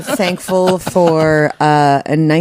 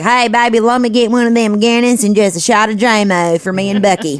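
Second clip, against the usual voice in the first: hammed-up Southern accent, a little raised; 4 semitones higher than usual. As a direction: order drinks as a Southern good old boy. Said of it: "it was Southern Irish rednecks".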